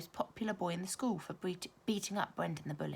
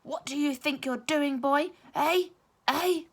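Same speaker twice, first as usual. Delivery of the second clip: loud, high angry teacher demand; loud high furious questioning; shouted; 9 semitones higher, 9 dB louder, slower than usual. A narrator's reading. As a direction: bark it down at the boy, pushing harder each time. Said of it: "Mister Irons stared down at Armit and shouted".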